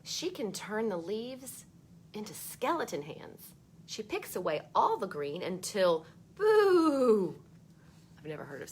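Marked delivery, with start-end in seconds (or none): 0.0-6.1 put-on bright read-aloud voice for children; storybook reading. A narrator's reading. Teacher reading a book to kids, lively read-aloud. 6.3-7.4 sudden loud playful boo, higher. Acted. Reader spooking young listeners, loud playful boo. Scary moment in the story.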